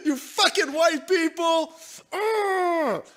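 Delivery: high, slow fake sob over the words; mock crying rant. Mocking the streamer with a hammed-up crying rant, high and slow. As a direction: ham up a whiny tearful complaint.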